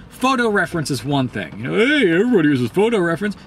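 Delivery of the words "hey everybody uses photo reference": higher, quick mock-defender voice; mocking objector. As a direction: rattle it off high, mimicking a defender. Mimics defenders of photo reference.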